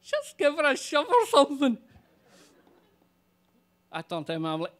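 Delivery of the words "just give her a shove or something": high squeaky voice, a little louder; squeak. Retold or imitated. Friend squeaking advice while stifling laughter, high squeak. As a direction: squeak it out through suppressed laughter. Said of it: "he squeaks".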